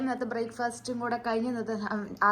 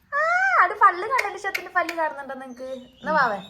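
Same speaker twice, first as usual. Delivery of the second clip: much higher, thrilled "പല്ല്"; 11 semitones higher, 5 dB louder than usual. Real, not acted. Excited showing baby's tooth.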